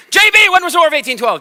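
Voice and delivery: loud, high and fast, pouncing on the student; teacher calling out. Retold or imitated. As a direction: catch her off guard with a sudden loud question. Teacher singles out J B.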